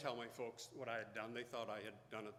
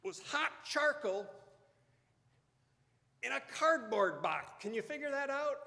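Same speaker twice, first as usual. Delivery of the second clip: higher, louder, wry firefighter; mock-incredulous fire crewman, raised and higher; 12 semitones higher, 8 dB louder than usual. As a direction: play the firefighter reporting to the dad, half amused. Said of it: "and he said".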